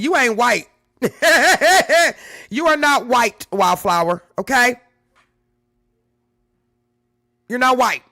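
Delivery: loud, laughing tease; playfully insist, cracking up; teasing laugh. Real, not acted. Teasing the commenter Wildflower, loud and laughing.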